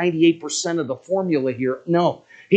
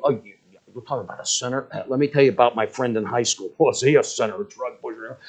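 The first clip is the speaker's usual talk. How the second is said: fast, lower, dismissive mock voice; mock self-righteous; 4 semitones lower, faster than usual. Preacher mocking a listener who blames others, quick and dismissive. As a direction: brush it off like a smug churchgoer.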